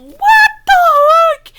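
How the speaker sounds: exasperated squeal. Real, not acted. Outraged at the promo.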